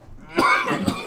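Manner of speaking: dry hacking cough; harsh coughing fit. Real, not acted. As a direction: cough hard, throat burning. Lingering pepper steam.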